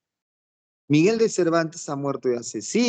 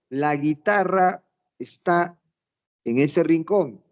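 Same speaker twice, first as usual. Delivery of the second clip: slow example sentence; 2 semitones higher, slower than usual. Shown as a demonstration. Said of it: "Si yo digo".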